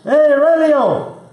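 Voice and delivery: high, drawn-out hail; calling out. Real, not acted. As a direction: call out to the remote guest, stretching the name. Greeting remote guest over video link.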